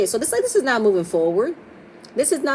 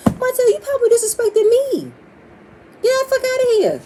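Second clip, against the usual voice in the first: high, loud, real outburst; outraged yell; 5 dB louder than usual. Real, not acted. Recapper shouting at Martell through the screen, furious. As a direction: blast him with indignant shouting.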